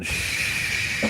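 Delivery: soft unvoiced shush; whispered shush. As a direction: hush them like a voice from above. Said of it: "It sounds like God saying".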